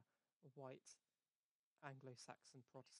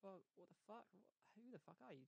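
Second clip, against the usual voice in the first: higher-pitched, incredulous retort; lightly acted affront, pitched up; mock indignation; 7 semitones higher, 3 dB quieter than usual. Man answers her complaint about clothes, in mock indignation. Retold quarrel over insulted clothes.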